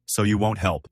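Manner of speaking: ordinary delivery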